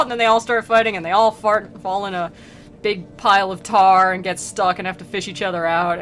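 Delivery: usual speaking voice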